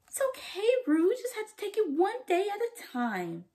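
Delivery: high, sugary know-it-all voice; mock the sweet advice; preachy Cassie. Vlogger mocking Cassie lecturing Rue, high and sugary.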